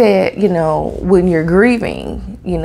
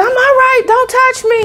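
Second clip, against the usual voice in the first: much higher, squeaky panicked insistence; panicky protest; 4 dB louder than usual. Retold or imitated. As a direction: squeal it out, fending her off. Panicked reply to funeral worker.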